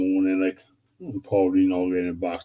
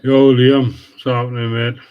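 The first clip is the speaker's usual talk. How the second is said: big, loud, higher hello to a viewer; loud greeting; 5 semitones higher, 8 dB louder than usual. Real, not acted. Streamer hails Liam joining the live stream, loud and upbeat. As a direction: shout a cheery welcome.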